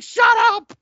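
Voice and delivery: a sudden high scream of panic; retold scream. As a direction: shriek it out like a freaking-out kid. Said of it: "And just starts screaming".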